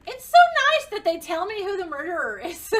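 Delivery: loud, high exasperated rant; real frustration. Real, not acted. Player upset at the murder, loud exasperated rant. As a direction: vent at the game. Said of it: "reacts to character's death".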